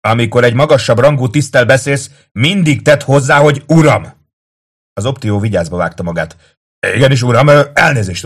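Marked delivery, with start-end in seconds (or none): 0.1-4.1 high, loud officer's dressing-down; snapped reprimand. A narrator's reading. Kato to a subordinate, snapping a reprimand. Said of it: "csattant fel Kato". 7.0-8.2 loud, high, hesitating apology; flustered acknowledgement. A narrator's reading. Rebuked optio to Kato, loudly flustered.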